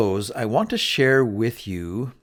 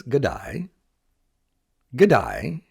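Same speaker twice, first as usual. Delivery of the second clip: Australian greeting G'day with a lifted end; a friend from Australia greeting you in Australian. Shown as a demonstration. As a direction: say G'day like an Aussie mate. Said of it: "they have the slang that says".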